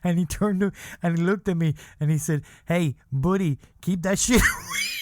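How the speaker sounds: building up through laughter; laughing. Real, not acted.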